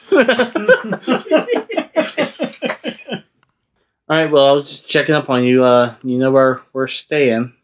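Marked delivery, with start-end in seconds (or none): 0.2-3.2 long, high-pitched giggling; helpless run of high giggles; real laughter. Real, not acted. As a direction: crack up at a silly joke. Laughing at snake nipples joke.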